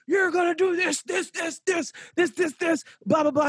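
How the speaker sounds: high mock coach rant; hammed-up angry coach lecture; mad coach. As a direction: rattle off a coach's angry list. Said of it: "came in all mad".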